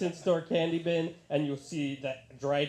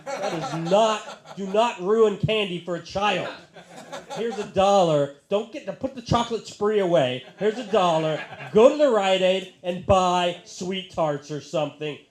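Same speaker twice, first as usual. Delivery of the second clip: loud, higher comic outrage; indignant scolding; 4 semitones higher, 8 dB louder than usual. Acted. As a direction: scold a mother in mock outrage.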